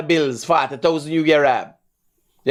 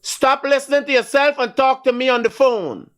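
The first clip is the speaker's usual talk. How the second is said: scolding; 7 semitones higher than usual. Real, not acted. Host scolding caller.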